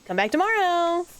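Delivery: high, loud call out; mock yell. Yelling after a fleeing creature.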